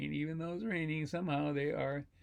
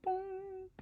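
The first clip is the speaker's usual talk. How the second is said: very high, softer chime; quiet ringing vocal effect; soft high bong; 5 dB quieter than usual. Acted. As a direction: echo a little bell tone. Sound effect repeated.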